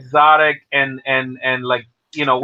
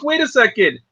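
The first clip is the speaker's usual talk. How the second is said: pitched up, asking in disbelief; wait, what are you even saying; puzzled and mocking at a commercial; incredulous retort; 7 semitones higher than usual. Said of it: "I'm like".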